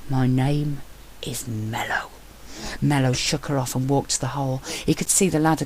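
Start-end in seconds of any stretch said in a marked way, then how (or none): 0.1-2.1 voiceless, clipped whisper; cold whisper. Acted. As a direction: correct her in a hard hush. Tense correction of name.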